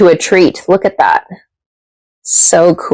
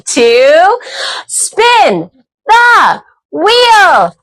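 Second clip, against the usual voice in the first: game-show host; 10 semitones higher, much slower than usual. Acted. Host hyping viewers before the spin, high announcer call. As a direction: build slowly, then call "spin the wheel" high.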